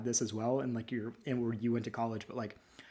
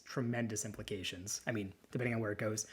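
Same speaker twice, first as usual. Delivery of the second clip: ordinary talk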